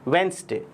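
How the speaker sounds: वेडनेसडे as "wens-day", first d silent; falling, teacherly "WENS-day" for वेडनेसडे; correct pronunciation. Shown as a demonstration. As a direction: model वेडनेसडे in two syllables. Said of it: "क्या है ये इसका सही pronunciation".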